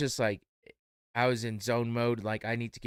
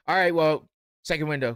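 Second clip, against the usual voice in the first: louder and higher, cutting the customer off; brusque dismissal; 5 semitones higher, 7 dB louder than usual. Retold or imitated. Cashier brushing off the customer, loud and curt. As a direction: brush him off to the next window.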